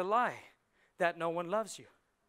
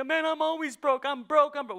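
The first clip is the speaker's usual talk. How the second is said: loud, much higher whining about being broke; mock complaint; 8 semitones higher, 9 dB louder than usual. Someone complaining to the church about being broke, loud whine. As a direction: moan it like a broke man. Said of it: "You're not gonna say".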